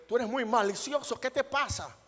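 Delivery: high-pitched, exasperated telling-off; parental scolding. Acted. Acted parent scolding a child.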